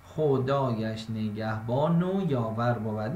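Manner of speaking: slow, syllable-by-syllable verse reading; slow, careful metrical reading. Shown as a demonstration. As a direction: read it out as you write the scansion.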